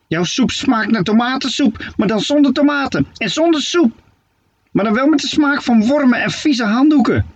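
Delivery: high, mocking witch voice. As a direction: sneer at the soup like a spiteful hag. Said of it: "zei Steketee". (hoge, spottende heksenstem)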